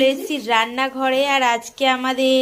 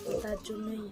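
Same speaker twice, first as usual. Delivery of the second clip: speaking softly; 11 dB quieter than usual. Real, not acted. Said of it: "আর আমি একটু আস্তে আস্তেই কথা বলছি".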